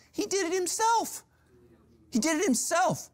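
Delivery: very high, incredulous squeal; high-pitched, hammed-up incredulity; comic disbelief. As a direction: squeak it out in mock amazement. Comic disbelief at the driver's self-damage.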